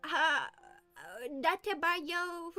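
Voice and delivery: hesitant mock anime voice, stretching datebayo slowly; half-remembered anime catchphrase, fumbled; a loose imitation of an anime hero; anime character imitation. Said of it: "I don't know what the fuck he says".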